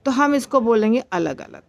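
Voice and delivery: usual speaking voice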